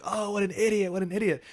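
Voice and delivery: high-pitched imitation of jeering classmates; mock jeer, high; imitated mockery. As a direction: mimic kids ridiculing someone. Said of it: "people covering their mouth and pointing at me".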